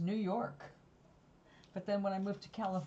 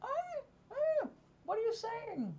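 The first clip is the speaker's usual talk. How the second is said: high, puzzled mother asking; hammed-up bewildered mother; mother's puzzled voice. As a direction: squeak it out like a baffled mum. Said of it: "was like".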